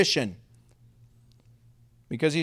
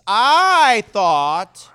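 huge drawn-out "I", loud and swooping high; booming, exaggerated "I" before a short "thought"; full emphasis on "I"; 4 semitones higher, 9 dB louder than usual. Shown as a demonstration. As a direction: stretch "I" out as loud as it goes, then "thought". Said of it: "Say it with emphasis".

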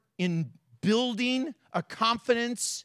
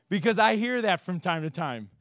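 normal talking voice

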